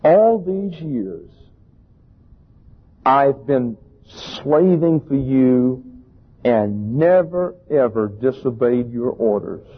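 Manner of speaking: slow, drawn-out self-righteous reading. Acted. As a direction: lean on every word with smug piety. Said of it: "acquire a self-righteous tone here as I read".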